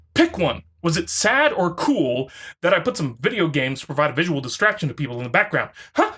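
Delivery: ordinary talk, nothing marked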